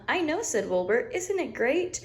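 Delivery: high, gleeful lamb voice; high excited Wilbur; delighted little lamb. Acted. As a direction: beam with pride, way up high. Wilbur catchphrase.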